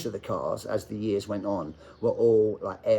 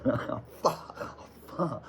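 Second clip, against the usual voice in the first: muttering to himself; 3 semitones higher, 6 dB quieter, much slower than usual. Retold or imitated. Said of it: "I'm thinking".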